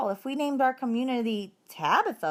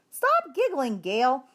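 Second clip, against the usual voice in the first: mild reproach; 6 semitones higher, 3 dB louder, slower than usual. Acted. Teacher scolds giggling girl.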